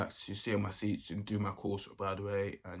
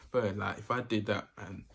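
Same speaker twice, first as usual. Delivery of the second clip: ordinary delivery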